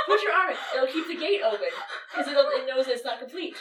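laughing through a quoted line; giggling while quoting. Retold or imitated.

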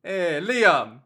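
loud put-on Jamaican accent. Podcaster calling co-host, accidental Jamaican accent. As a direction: slip into a mock Jamaican accent. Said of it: "why did I go Jamaican?".

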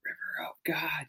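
whisper. Real, not acted. Echoing a pitch title conspiratorially.

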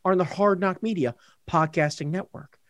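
lightly grand narrator voice, slowing; a hint of legend telling; epic fantasy narrator. Intro parody narration.

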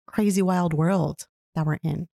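ordinary talk

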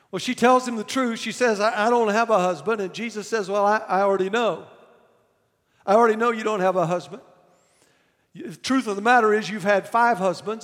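loud, quick sermon delivery; preaching. Acted. Preacher voicing Jesus to the woman, loud and quick. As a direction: drive the story hard from the pulpit.

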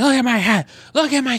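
very high mock yell; ranting fan. Fan showing his Bulls gear, hammed-up high yelling. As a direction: imitate an insistent, overexcited fan.

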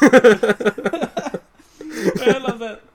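loud, high burst of delight; excited outburst. Real, not acted. Friend reacting to the comic panel, loud and high delight. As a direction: blurt it out with real glee.

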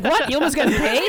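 high, loud shocked squeak; mock disbelief. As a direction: act floored by the news, laughing. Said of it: "I was like".

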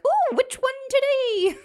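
high, slow teasing coo; playful high voice. Pretend. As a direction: coo it like choosing a treat. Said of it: "be like".

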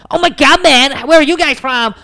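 loud, high, over-excited American golfer impression; gushing, hammed-up enthusiasm; happiest man alive. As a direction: yell it like a wildly upbeat American. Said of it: "he is, like, the happiest man alive".

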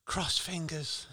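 drawn out, high pitched; slow and high. Acted. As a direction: stretch it out slowly. Said twice, first drawn out.

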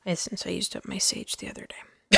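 whispered aside. Real, not acted. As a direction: whisper it secretly. Confiding aside about sage.

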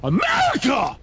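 high proud yell; patriotic shout. Acted. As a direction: shout it like a rally cry. Patriotic yelled outburst.

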